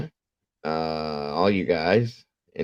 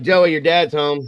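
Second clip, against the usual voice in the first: louder, higher mock call upstairs; retold calling out; 7 semitones higher, 7 dB louder than usual. Mother calls son up from the basement, calling out. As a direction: call out as if to another floor.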